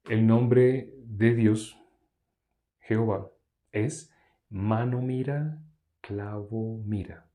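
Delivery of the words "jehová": normal talking voice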